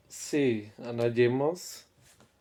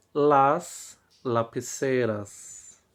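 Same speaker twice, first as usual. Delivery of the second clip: slow teaching demo; slower than usual. Shown as a demonstration. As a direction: stretch out "las lapiceras" slowly, leaning on "las". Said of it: "teacher models the plural slowly".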